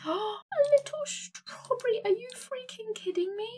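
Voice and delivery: thrilled high-pitched disbelief; excited squeal. Real, not acted. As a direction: squeal like you can't believe it. Squealing at cute accessory.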